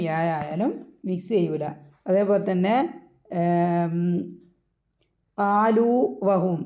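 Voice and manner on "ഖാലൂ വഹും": ഖാലൂ വഹും slowly, with ഖാലൂ kept apart from വഹും; ഖാലൂ വഹും said slow, the two വാവ് not merged; unmerged letters. Shown as a demonstration. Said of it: "രണ്ടും കൂടി mix ചെയ്യൂല". (ഖാലൂ വഹും, വാവുകൾ കൂട്ടാതെ പതുക്കെ)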